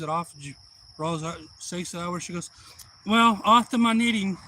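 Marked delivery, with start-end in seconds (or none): 3.0-4.4 loud, high-pitched granny announcement; chatty granny. Retold or imitated. Granny announces her knitting.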